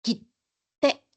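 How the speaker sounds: "kite" chopped into "kit", silence, "te"; "kit...te" with a stopped T; cut-off doubled T. Shown as a demonstration. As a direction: cut the sound after "ki" and hold before "te". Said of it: "Vamos a cortar el sonido".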